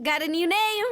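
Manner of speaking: ordinary talk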